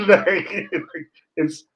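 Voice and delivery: amused disbelief, laughing while telling it. Real, not acted. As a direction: talk through real laughter. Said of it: "I'm laughing now".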